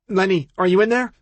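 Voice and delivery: high, loud call through a door; woman calling through door. Acted. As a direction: call out from outside, muffled. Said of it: "a woman's muffled voice".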